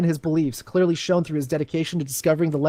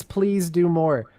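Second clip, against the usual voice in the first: slow drawn-out plea; much slower than usual. Real, not acted. Sarcastic plea about documents.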